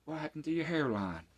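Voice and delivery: high, loud mocking jab; imitating J J teasing. Old roofer mocks young coworker, high mocking teasing voice. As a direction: rib the kid about his hairline, voice climbing. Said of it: "He's like".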